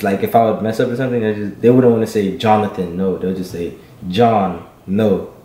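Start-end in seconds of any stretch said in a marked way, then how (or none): none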